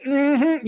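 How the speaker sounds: high-pitched "mm-hmm"; high imitated hum of approval; imitated audience mm-hmm. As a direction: hum along in mock agreement. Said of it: "A lot of".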